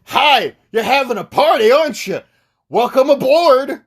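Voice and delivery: lightly voiced cheery host; mildly voiced welcoming guest; party guest greeting.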